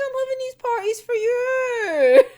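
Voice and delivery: high, loud put-on whine; a silly high character voice defending himself; mock whiny excuse. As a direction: squeal it like a guilty excuse. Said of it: "It's like".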